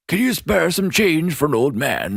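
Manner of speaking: put-on old man voice. Voicing a beggar in the show.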